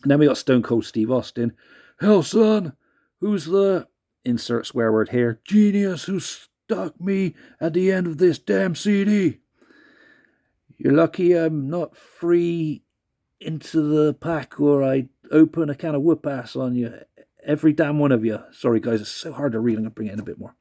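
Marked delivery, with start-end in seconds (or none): 2.0-3.9 hammed-up Texan tough guy; Stone Cold impression. 5.4-18.4 hammed-up Texan tough guy; Stone Cold impression.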